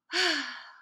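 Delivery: a long wistful sigh. Acted. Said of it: "with a sigh".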